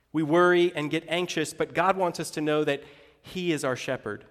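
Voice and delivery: ordinary talk, nothing marked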